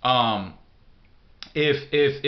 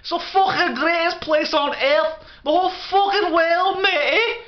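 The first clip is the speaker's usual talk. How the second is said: very high, squawking Scouse parody; hammed-up Liverpool accent, pitched way up; mock Scouse accent; 3 dB louder than usual. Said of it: "They got that Scouse".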